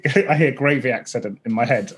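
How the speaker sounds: normal talking voice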